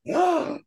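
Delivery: surprised gasp. Real, not acted. Reacting to Pussycat Doll news.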